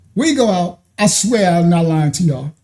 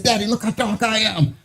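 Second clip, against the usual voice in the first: put-on softer little-kid voice; proud little son; 2 semitones higher, 7 dB quieter than usual. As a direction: play the boy showing off. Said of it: "he said".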